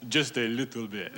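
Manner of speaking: put-on modest reply in a French accent; fake French accent. Fake Frenchman answering an American tourist, French accent. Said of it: "I'm just a poor Frenchman".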